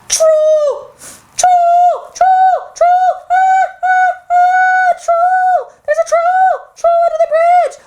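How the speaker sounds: very high, playful panicked cries; mock panic. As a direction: squeal in pretend terror at a monster.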